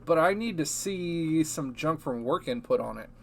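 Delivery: ordinary talk, nothing marked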